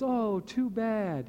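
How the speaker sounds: high, slow, drawn-out pity; pitying villagers. Retold or imitated. Villagers pitying the farmer over his son's broken arm, high and slow. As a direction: sigh it out like sorry neighbours. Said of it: "And the whole village goes".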